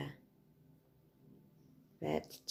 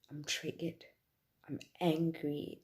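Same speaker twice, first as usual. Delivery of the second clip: ordinary talk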